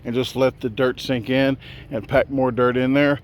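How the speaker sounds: big, loud showman's announcement; announcer voice. Acted. To viewers, proudly announcing the finished foundation, loud announcer voice. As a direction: present it like a ringmaster.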